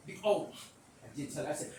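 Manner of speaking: ordinary delivery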